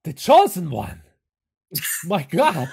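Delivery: loud, awestruck proclamation in character; hammed-up astonishment, breaking into laughs; Igor. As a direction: proclaim it like a prophecy. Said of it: "and Igor says".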